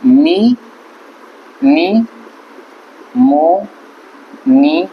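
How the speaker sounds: नी, नी, मो, नी stressed loud, one by one; syllable by syllable. Shown as a demonstration. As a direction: break निमोनिया into loud syllables. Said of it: "इसको बोलते हैं निमोनिया". (निमोनिया, एक-एक अक्षर ज़ोर से)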